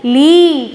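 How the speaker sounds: "ली" with its final ee held long and loud; long-vowel demonstration. Shown as a demonstration. Teacher showing children the ending sound, "ली" stretched long. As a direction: draw out the ee of "ली" strongly. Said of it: "ध्वनि आएगी वो कैसी आएगी".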